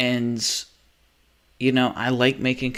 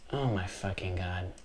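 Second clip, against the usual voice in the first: muttered exasperation; 5 semitones lower, 11 dB quieter than usual. Real, not acted.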